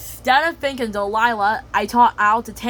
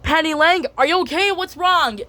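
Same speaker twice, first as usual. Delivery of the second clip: worried call; 8 semitones higher, 4 dB louder than usual. Acted. Child voicing Penny calling out.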